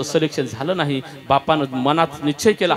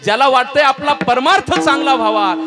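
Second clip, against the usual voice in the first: preaching emphasis; 9 semitones higher, 5 dB louder than usual. Acted. Preacher urges listeners.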